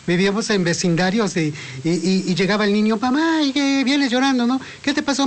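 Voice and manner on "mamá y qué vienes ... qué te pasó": voz de mamá bastante más aguda, algo imitada; madre preocupada imitada. Retold or imitated. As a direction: imita a la mamá que pregunta al hijo. Madre pregunta al niño en historia. (mamá que pregunta al hijo qué le pasó, voz aguda imitada)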